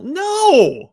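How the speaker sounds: shouted high mock denial; loud mock no. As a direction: yell a high NO.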